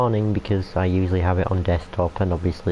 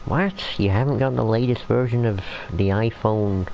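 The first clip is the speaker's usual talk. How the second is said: mocking, incredulous put-on American accent; a teasing American accent, as if shocked at an old phone; American accent, lightly hammed-up disbelief; 2 semitones higher than usual. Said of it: "I don't know why I'm using an American accent".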